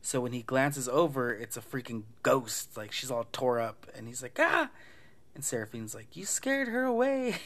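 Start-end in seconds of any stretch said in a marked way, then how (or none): 4.4-4.7 short high, loud shriek; hammed-up fright scream; mock startled scream. Retold scream at ghost. 6.2-7.4 high-pitched hammed-up wail; imitating an aggrieved girl; mock upset accusation. Retold whiny scolding by Seraphine.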